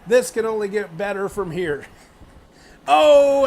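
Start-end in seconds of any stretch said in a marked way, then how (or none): 2.9-3.5 sudden loud high "Oh" at a big hit; excited shout. Real, not acted. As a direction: burst out at the impact. Big hit in wrestling commentary.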